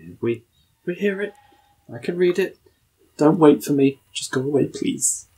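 normal talking voice

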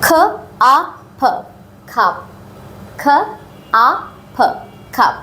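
খাপ, meaning cup, split into খ, আ, ফ then blended, twice; sounding out. Shown as a demonstration. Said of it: "সিইউপি".

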